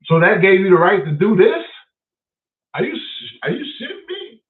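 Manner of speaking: rising, incredulous challenge; indignant outrage. Retold or imitated. Homeowner on speakerphone challenging the uncle, incredulous.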